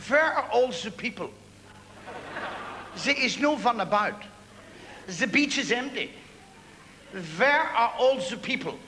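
a hint of a Northern Irish lilt, slow; lightly voiced Belfast German asking the empty beach; German with Belfast accent. German tourist on the empty beach, mild Belfast accent. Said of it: "He was a German with a Belfast accent".

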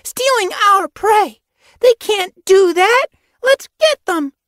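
very high, loud outrage, drawn out; rally for a chase, high-pitched and loud; indignant exclamation. A narrator's reading. Apprentice ready to charge after prey thieves, exclaiming. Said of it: "Foxpaw exclaimed".

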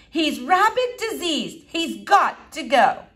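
high, slow, emphatic mother; alarmed insistence. Acted. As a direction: hammer out each word as a demand. Mother demands squirrel be removed.

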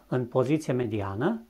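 normal talking voice

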